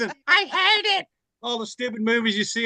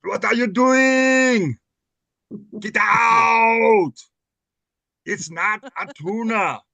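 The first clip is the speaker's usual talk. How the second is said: slow, loud Austrian Arnold bark; Schwarzenegger impression; 3 semitones higher, 4 dB louder, slower than usual. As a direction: shout it like Arnold. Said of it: "Comes Arnold Schwarzenegger around".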